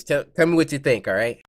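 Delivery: ordinary talk, nothing marked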